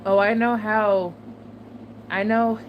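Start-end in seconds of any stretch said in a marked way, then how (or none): none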